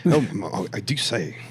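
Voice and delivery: put-on British accent. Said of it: "setting of a British sitcom".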